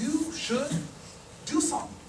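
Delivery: soft, suspenseful pause mid-phrase; mock-profound revelation; portentous wisdom. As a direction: hold back, then drop it quietly. [name] delivers anticlimactic wisdom.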